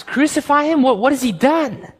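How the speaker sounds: very high, incredulous question; shocked disbelief. Acted. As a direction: Pilate can't believe his ears. Pilate shocked by the crowd.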